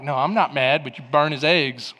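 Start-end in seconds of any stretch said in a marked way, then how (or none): 0.0-0.8 low passive-aggressive denial. Acted. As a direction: deny it in a lowered, acted passive-aggressive tone. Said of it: "maybe you're passive aggressive".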